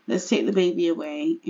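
normal talking voice

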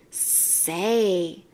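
a low, soft snake voice; a sleepy snake chiming in; low, quiet snake, lightly done; lightly voiced snake. A narrator's reading. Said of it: "the snake interrupted".

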